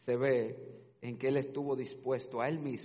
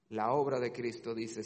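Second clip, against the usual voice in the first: ordinary delivery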